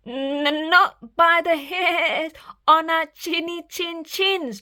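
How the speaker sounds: high, quavering piglet voice; trembling defiance. Acted. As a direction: shake as you say it. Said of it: "trembled Boris".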